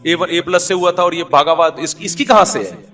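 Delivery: loud, high, driving sermon voice; fiery preaching. Acted. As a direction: preach it hard to the crowd. Preaching at full force.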